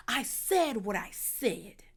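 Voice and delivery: hushed, put-on stage-diva delivery; Tyler Perry voice. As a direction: stretch the line out, softly melodramatic. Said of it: "in her best Tyler Perry voice".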